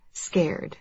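plain reading voice

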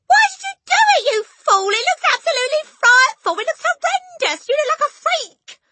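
very high, loud scolding shriek; horrified shriek. A narrator's reading. Mother berating husband over hair, shrieking. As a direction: shriek a tirade at him. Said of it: "shrieked the mother".

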